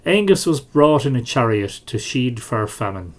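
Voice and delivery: ordinary delivery, nothing marked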